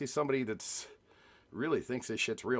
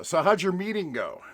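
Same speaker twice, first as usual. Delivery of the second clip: mock chatbot voice; 8 semitones higher, 7 dB louder than usual. Reading the chatbot's line aloud.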